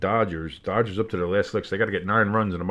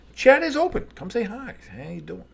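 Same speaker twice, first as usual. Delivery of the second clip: playful greeting; 11 semitones higher than usual. Pretend.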